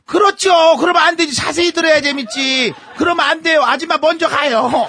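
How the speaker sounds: very high-pitched, emphatic objection; hammed-up indignant protest; another bus auntie. A narrator's reading. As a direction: chime in like a meddling auntie. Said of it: "그때 또 다른 아줌마가".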